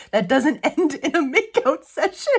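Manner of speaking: reading aloud through real giggles; breaking into laughter. Real, not acted. Reader sharing Grey's Anatomy elevator joke, breaking into laughter. Cracking up while reading funny passage.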